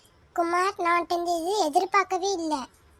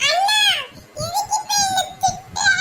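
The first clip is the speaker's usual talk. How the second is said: much higher, wailing through tears; crying whine; 12 semitones higher, 3 dB louder than usual. Acted. Little brother crying at his sister for scolding him, whining. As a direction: sob it out like a hurt kid. Child cries at being scolded.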